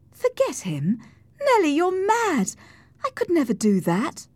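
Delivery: high-pitched, indignant disbelief; incredulous protest. Acted. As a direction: scoff at an absurd suggestion. Cathy rejects Nelly's advice.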